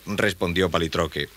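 ordinary delivery, nothing marked

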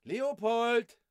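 high-pitched call of a name. Acted. A voice summons Leopold out of his musing, calling out. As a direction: call him from afar.

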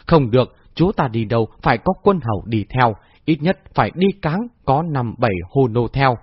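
usual speaking voice

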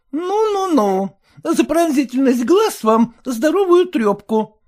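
high, sour, fretful wife voice; high complaining wife; sour merchant's wife. A narrator's reading. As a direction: whine it like a sour housewife. Mother threatens.

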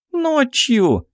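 put-on very high girlish shriek without much volume; young archer's squeal. A narrator's reading. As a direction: squeal the answer eagerly. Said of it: "взвизгнула молоденькая лучница". (высокий девичий визг)

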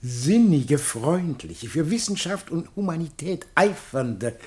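ceremonious address with long pauses between the titles; pompous public speech; formal speech. Acted. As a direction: address the assembled society solemnly, like a festive orator.

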